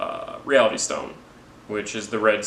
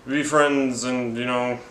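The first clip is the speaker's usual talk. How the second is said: higher, slow buddy-up voice; hammed-up offer to team up; mock hero pledge; 4 semitones higher, slower than usual. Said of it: "they each find out".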